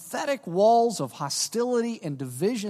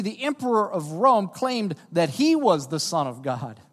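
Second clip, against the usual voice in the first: usual speaking voice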